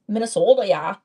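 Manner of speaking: put-on playful Minnesota accent with a tacked-on "yeah". Vlogger mimics Minnesotan viewers agreeing, mock Minnesota accent. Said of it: "Do y'all actually talk like that?".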